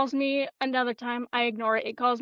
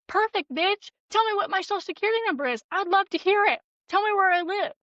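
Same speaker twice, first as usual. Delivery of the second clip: higher, quick goading retort; acted-out daring challenge, pitched up; mock-eager taunt; 7 semitones higher, faster than usual. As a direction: call the bluff with sweet relish. Retelling her taunt to the scammer.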